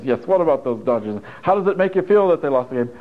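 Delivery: pretend-guy talk. Boyfriend pretending to be a guy, lightly hammed-up. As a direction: imitate someone trying to pass as a guy. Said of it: "how to be a man so that he can pretend".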